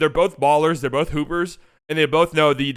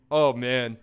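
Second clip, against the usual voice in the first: usual speaking voice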